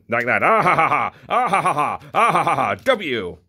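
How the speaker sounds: play-acted hungry monster with nom-noms and cackles; silly car-eating creature voice; munching toy monster. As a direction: gobble noisily, then cackle in bursts.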